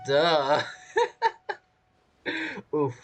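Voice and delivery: normal talking voice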